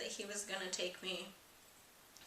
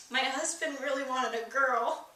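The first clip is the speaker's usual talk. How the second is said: tearful; 6 semitones higher, 7 dB louder than usual. Retold or imitated. To the doctor, crying over her late husband's wish. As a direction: voice breaking with tears, higher and louder. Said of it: "I just immediately started crying".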